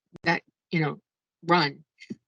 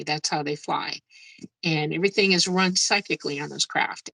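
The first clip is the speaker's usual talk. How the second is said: normal talking voice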